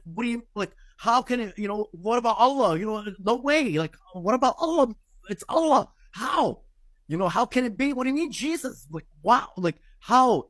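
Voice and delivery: high, frantic disbelief, voice climbing; panicked disbelief. Retold or imitated. Man arguing with the angel about Allah, high frantic disbelief. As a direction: pitch up, baffled and frantic. Retelling his panicked denial.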